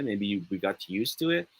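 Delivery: ordinary talk, nothing marked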